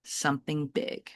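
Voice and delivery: ordinary delivery, nothing marked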